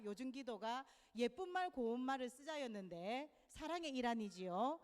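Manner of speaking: ordinary delivery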